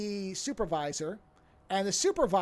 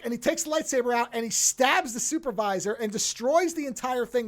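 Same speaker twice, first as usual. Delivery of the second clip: ordinary delivery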